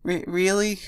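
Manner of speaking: a hurt little sniffle; sniffling. Acted. Pinkie asking Andrew through sniffles, tearful. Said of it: "she sniffled".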